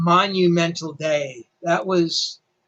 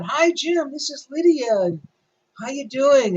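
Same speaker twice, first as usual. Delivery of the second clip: higher, bright phone greeting voice, lightly imitated; mimicking Lydia on the phone; 6 semitones higher than usual. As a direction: play the friendly caller greeting Jim. Retold friend phoning the editor.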